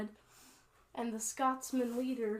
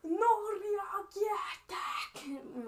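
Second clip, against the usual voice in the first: harsh, high drawn-out war shout; orc battle cry; 10 semitones higher, 5 dB louder, much slower than usual. Acted. Orc leader in skull armor rallying his orcs, battle cry.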